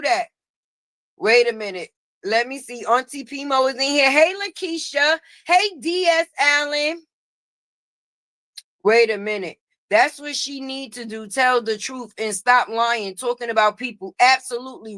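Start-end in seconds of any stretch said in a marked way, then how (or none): none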